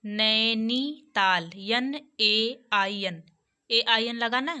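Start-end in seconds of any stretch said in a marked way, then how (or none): 0.0-1.5 नैनीताल very slow, syllables held; slow teaching demo of नैनीताल; drawn-out vowel. Shown as a demonstration. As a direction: stretch "nai" and "nee" in नैनीताल. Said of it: "ए sound based".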